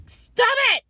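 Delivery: exasperated high shout. Real, not acted. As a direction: yell it to cut them off. Angry outburst in rant.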